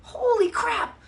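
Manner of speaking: high yelp of shock; startled exclamation. Retold or imitated. Watching a squirrel buzz across the wire, a high startled yelp. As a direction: blurt it out, caught off guard. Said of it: "I'm like".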